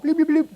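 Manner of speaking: high-pitched electronic bloops; cartoonish high modem noises; imitated modem beeps. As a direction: mimic a dial-up modem connecting.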